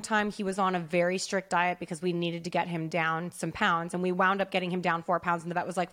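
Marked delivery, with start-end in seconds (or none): none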